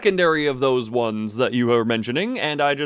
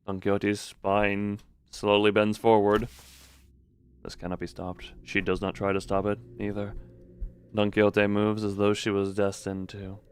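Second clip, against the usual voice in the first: story narration; 5 semitones lower, 5 dB quieter than usual. A narrator's reading. Reads game narration.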